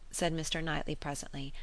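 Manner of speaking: plain reading voice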